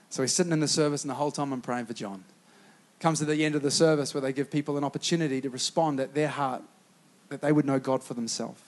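soft, low preaching appeal; hushed invitation to respond; quiet altar call. Acted.

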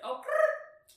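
high, loud rolled R. Shown as a demonstration. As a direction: trill the R with a squeal. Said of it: "We were practicing".

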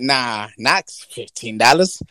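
ordinary talk, nothing marked

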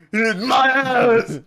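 slow, loud laughing cry; mock outrage. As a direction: drag it out in fake shock.

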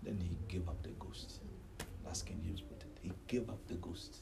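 very low, near-whispered telling of a death; solemn hush. Real, not acted. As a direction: drop to a hush and let it die away. Preacher narrates the death.

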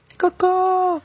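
loud, high mock wail; re-enacted desperation. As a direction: cry it out in exaggerated horror. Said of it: "Eu desesperada, no meio da aula, tipo assim". (desespero encenado, grito agudo)

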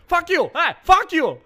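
high, loud trash-talk voice; gamer troll voice. Shown as a demonstration. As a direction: yell like a raging kid. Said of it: "I'm just saying fuck you and, like, a".

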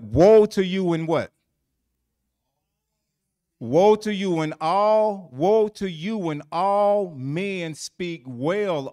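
loud, slow preaching of the woe; hammered pulpit warning; booming scripture. Acted. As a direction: thunder out the woe slowly. Preacher booms scripture slowly.

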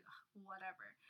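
quiet annoyed sigh. Retold or imitated. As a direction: give in with a groan. Giving up annoyed.

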